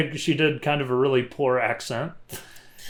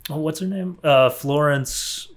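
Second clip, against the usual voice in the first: usual speaking voice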